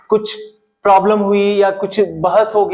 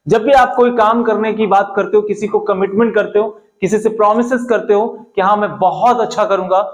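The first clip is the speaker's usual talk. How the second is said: ordinary delivery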